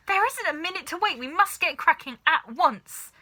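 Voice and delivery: fast, high, loud urgency; no time to lose; impatient shout. A narrator's reading. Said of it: "shouted Mister Cranky".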